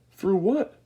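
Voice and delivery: put-on high, loud puzzled old man; shouting. A narrator's reading. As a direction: shout back in alarm. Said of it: "shouted Grandpa Joe".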